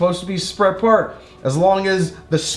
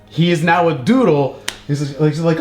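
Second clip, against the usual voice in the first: ordinary delivery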